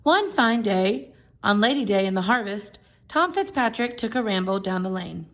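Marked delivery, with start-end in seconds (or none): none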